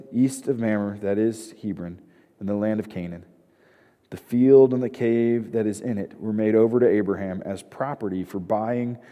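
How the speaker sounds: hushed Bible reading voice; scripture reading. Acted. Reads the scripture passage aloud.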